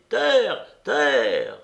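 loud, long falling call of a lookout; sailor's cry. A narrator's reading. A sailor announcing land at sea, joyful cry. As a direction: sing out like a sailor sighting land. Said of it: "qu'aurait eu un marin à crier".

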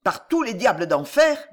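ordinary delivery

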